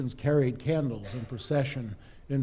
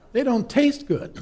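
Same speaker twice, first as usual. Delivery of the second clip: laughing; 8 semitones higher than usual. Real, not acted.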